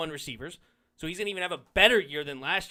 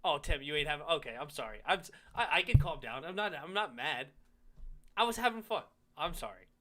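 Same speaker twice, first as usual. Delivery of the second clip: apologetic calm-down; 4 semitones lower, 6 dB quieter than usual. Real, not acted. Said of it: "I'm sorry if it sounded like I was too angry".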